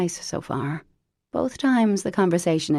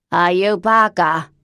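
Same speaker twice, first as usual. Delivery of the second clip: loud, put-on voice of an old chain smoker; Lavinia's horrible voice; 8 dB louder than usual. Cousin Lavinia phoning Parker, loud put-on smoker's voice. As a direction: bark it out like a lifelong smoker.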